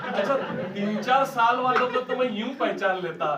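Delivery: amused laughing delivery. Real, not acted. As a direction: laugh it out while speaking. Laughing aside about customers.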